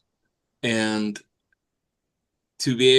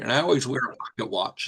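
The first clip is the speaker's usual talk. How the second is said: ordinary talk, nothing marked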